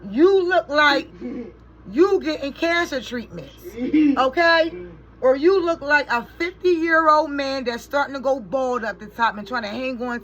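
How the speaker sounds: high, loud, exasperated scolding; annoyed scolding. Real, not acted. Mother scolds Shawnee for laughing at her son, high and loud.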